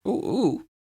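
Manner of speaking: high cooing cluck; chicken sound. A narrator's reading. The chicken chiming in after Lydia, high cooing. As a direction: coo like a hen joining in. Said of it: "added the chicken".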